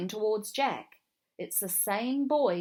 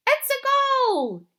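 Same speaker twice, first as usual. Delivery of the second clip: much higher, louder, drawn-out cry; stretched, jubilant goal call; goal cheer; 11 dB louder, slower than usual. A narrator's reading. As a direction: shout it out as the ball hits the net.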